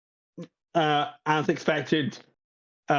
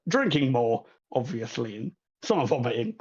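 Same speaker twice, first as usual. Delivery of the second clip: normal talking voice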